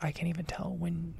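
ordinary talk, nothing marked

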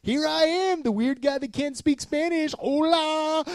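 high, loud hammed-up greeting; overly friendly. Customer walking into taco place, overly friendly. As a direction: greet them in a drawn-out, chirpy high voice. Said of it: "I'm always friendly when I go in".

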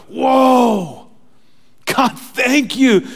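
high, loud, drawn-out cry of thanks; awed exclamation. Acted. Congregation answers God's love at Easter, loudly awestruck. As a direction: shout it from the gut. Acting awed gut response to God.

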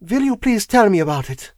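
high-pitched, composed foreign woman; calm request in a foreign accent; composed foreign wife. A narrator's reading. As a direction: play a self-possessed foreign wife. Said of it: "perfectly calm and composed".

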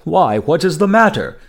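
a touch of amused surprise. A narrator's reading.

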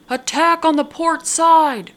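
high, loud alarm shout; lookout's cry. A narrator's reading. Lookout above warning the crew of boarders, loud cry. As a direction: call it down from the mast in alarm. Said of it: "cried the voice from above".

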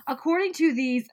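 ordinary talk, nothing marked

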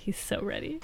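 gleeful whisper. Real, not acted. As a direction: hush it like a secret. Gleeful aside.

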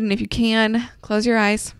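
ordinary talk, nothing marked

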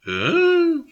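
high, drawn-out exhaled "ooh"; wincing "whoo". Real, not acted. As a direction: let out a long high "whoo". Reacting to a harsh passage read.

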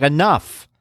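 firm, clipped parental enough. Retold or imitated. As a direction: put your foot down on Enough. Parent loses patience with child.

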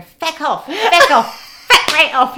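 mock Irish accent, loud and high feck off; Irish accent, shouting her down; an Irish patient shouts at another woman, Irish accent; Irish woman yelling. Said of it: "like this".

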